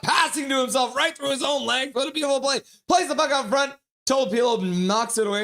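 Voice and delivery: loud, high and fast-rising call of the play; breathless real excitement over a great play; excited play-by-play. Real, not acted. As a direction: call it like the crowd just jumped up.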